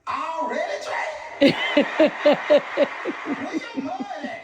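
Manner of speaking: put-on high-pitched little girl voice; Helena. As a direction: pipe up high like a seven-year-old. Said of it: "Helena comes out her room and says".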